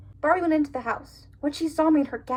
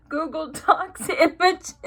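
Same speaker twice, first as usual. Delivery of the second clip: laughing; 3 semitones higher, slower than usual. Real, not acted. Laughing at her own slip.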